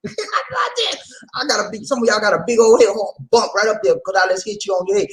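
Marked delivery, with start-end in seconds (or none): 0.1-1.1 laughing ouch. Pretend. Pretend hit on the head.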